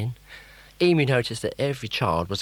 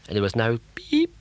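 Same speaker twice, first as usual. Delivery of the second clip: usual speaking voice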